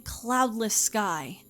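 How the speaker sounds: plain reading voice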